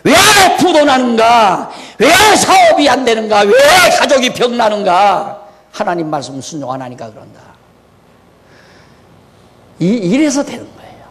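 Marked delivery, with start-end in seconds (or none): 0.0-5.4 shouted, high accusing questions; shouted mock sermon questions; mock preacher shouting. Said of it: "이걸 안 가르치고". 5.7-7.4 quick, much softer and lower verdict; hushed mock accusation. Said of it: "이걸 안 가르치고".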